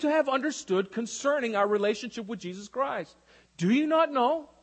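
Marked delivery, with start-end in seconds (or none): 3.6-4.5 high rising disbelief; incredulous. Retold or imitated. Paul rebuking the church, high incredulous question. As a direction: ask it in plain astonishment. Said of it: "He's very incredulous".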